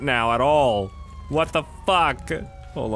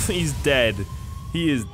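normal talking voice

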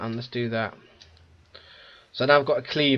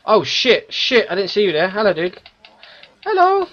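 higher, louder startled outburst; startled yelp; 5 semitones higher, 4 dB louder than usual. Real, not acted. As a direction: jump at the enemy with a yelp. Surprised by enemy behind him.

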